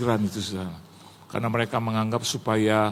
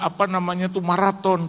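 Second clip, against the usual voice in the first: normal talking voice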